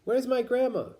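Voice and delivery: put-on high child's frightened call; mock yell. Child calling for grandma at the fire, high frightened yell. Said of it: "I yelled".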